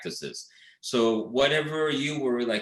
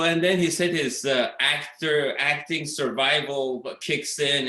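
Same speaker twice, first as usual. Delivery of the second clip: ordinary talk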